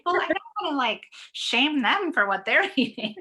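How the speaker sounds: high, put-on voice of her squeamish self; mock protest. Guest answering her nutritionist in a high mock protest. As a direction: pitch it up, protesting politely.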